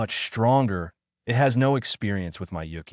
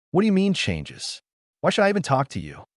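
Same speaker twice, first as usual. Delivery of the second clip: angry rant; 9 semitones higher than usual. A narrator's reading. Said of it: "going on with his rant".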